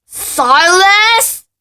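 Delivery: very high, loud yell. Acted. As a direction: bellow for silence. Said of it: "yelled Uncle Vernon".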